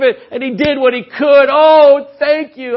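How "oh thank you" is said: delighted thanks. Retold or imitated. Thanking child for gift.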